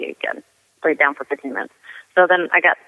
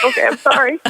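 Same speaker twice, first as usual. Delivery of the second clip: higher-pitched, chastened sorry; meek apology; 7 semitones higher than usual. Retold or imitated. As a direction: back down meekly, voice lifted. Retold meek apology after scolding.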